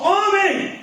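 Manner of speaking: high-pitched creator's proclamation; commanding call. Retold or imitated. God speaks man into existence, calling out commandingly.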